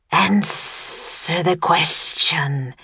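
very slow monster command; booming serpent voice. A narrator's reading. Serpent demanding an answer from Jack, slow booming voice. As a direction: draw out the words, lingering on the S. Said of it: "the serpent boomed".

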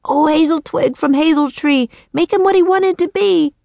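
high, urgent incantation; shouted spell. A narrator's reading. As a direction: cry out a magic rhyme, pitched high.

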